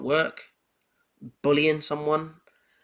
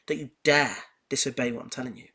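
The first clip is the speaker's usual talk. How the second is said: normal talking voice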